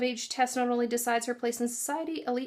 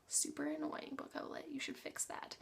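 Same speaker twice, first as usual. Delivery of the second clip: whispering; 3 semitones higher, 13 dB quieter than usual. Real, not acted. Mock scolding aside to company.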